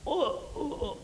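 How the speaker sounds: high, soft moaned "oh, oh"; imitated moaning "oh"; imitated release noise. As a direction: mimic a soft wail of something leaving. Imitates wind leaving the body.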